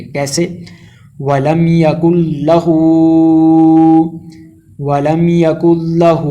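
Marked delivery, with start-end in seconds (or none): 1.2-6.3 recited with the final هُ held long; lengthened hu. Shown as a demonstration. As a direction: hold the هُ of لَهُ for a full second. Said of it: "ہو کو ایک second تک لمبا کریں گے".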